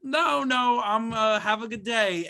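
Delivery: mock flustered coworker saying no; nervous coworker brush-off. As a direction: back away politely with a high nervous voice. Coworker brushing off creepy guy.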